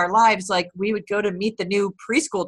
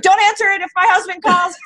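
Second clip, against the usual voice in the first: high, loud, laughing plea; playful panic, pitched way up; mock panicked order; 10 semitones higher, 5 dB louder than usual. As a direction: retell it as a laughing, urgent command. Mock plea to radio colleagues.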